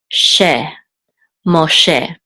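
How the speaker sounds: "she" alone, then blended into "Moshe"; syllable demo. Shown as a demonstration. Teacher assembling the name in Hebrew, careful demo. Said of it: "this is the trope or accent mark".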